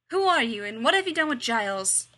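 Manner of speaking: Buffy confronts the fake Giles, loud and ringing; ringing challenge. A narrator's reading. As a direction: call out to the impostor, loud. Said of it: "Buffy's voice rang through the silence".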